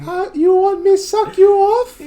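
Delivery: very high, slow falsetto; mock maid voice. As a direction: squeak it like a cartoon maid. Quoting movie maid line.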